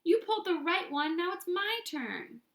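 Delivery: high pigtail voice. A narrator's reading. Pigtail demanding its turn, high and childlike. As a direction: pipe up as the little pigtail.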